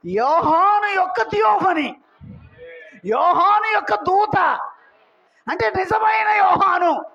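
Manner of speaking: high, emphatic proclamation with stretched words; full-force preaching. Real, not acted. Preacher revealing to congregation who the elder is, high emphatic preaching. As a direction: proclaim a revelation, phrase by phrase.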